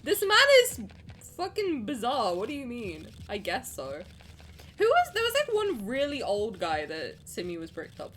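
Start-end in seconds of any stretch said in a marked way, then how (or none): 0.0-2.4 exclaiming. Real, not acted. Outburst about the villain.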